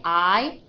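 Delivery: I said as a drawn-out "eye"; long I. Shown as a demonstration. Teacher isolates the vowel for a student, long I. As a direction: sound out I like "eye". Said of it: "with the long I".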